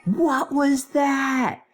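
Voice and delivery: very high, drawn-out screamed question; hammed-up scream without full volume; mock scream. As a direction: stretch each word in a high, controlled shriek. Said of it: "[name] screamed".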